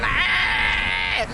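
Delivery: imitated drawn-out scream; baby's yell. Brother retelling the movie scare, mock yelling. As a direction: mimic the baby's long holler. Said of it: "That baby come out there, yelling".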